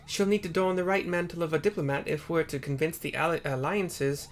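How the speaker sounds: put-on lifted, earnest young scholar voice. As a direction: read her lines up high and eager. Character dialogue read aloud.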